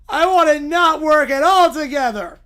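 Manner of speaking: loud, high petulant refusal; whiny Little Bear. Acted. Little bear refusing to work together, loud whine. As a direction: dig in like a bawling cub.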